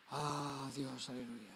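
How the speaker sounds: quiet exhaled word of praise; hushed praise. Real, not acted. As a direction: let it drop to a quiet murmur. Quiet sigh after the shouted prayer.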